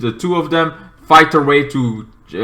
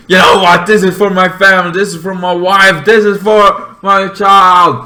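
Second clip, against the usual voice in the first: higher, louder avenging cry; vengeful declaration; 6 semitones higher, 5 dB louder than usual. Retold or imitated. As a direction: hurl each line as payback. Scorpion's revenge declaration.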